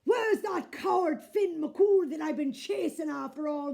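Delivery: a bullying giant roaring for Finn; loud blustering giant; booming giant. A narrator's reading. Giant voiced in the story.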